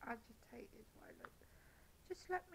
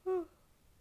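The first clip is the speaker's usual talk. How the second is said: heavy sigh; 9 semitones higher, 13 dB louder than usual. Real, not acted. Pauses, struggling to go on.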